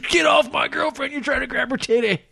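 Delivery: high, strained mock outrage; outraged boyfriend. Boyfriend warning off a would-be helper, mock-angry high yell.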